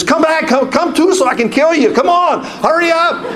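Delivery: high, panicky mock yelling; frantic urging. Passenger slapping the passed-out pilot, high frantic pleading. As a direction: urgently rouse someone out cold, pitch soaring.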